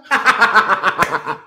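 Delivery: loud staccato cackle; loud laugh. Real, not acted. Laughing at his own retold shouting match, loud laugh. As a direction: burst out in a long run of ha-ha.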